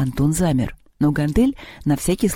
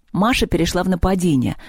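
ordinary delivery

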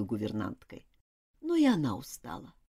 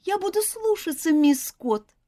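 put-on pleading child; 12 semitones higher than usual. A narrator's reading.

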